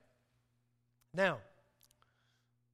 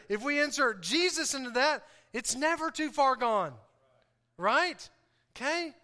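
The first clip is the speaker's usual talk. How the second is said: raised, emphatic preaching voice; 6 semitones higher, 5 dB louder than usual. Acted. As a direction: drive each point home to the congregation. Preaches with force, later talks plainly.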